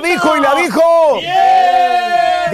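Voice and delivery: high, loud victory cheer; celebratory shout. Real, not acted. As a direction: cheer like she just won. Host celebrating a win.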